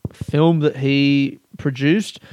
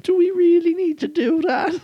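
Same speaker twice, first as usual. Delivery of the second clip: much higher, put-on voice; mock prim objection. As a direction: mimic a prissy scold. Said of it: "He's like".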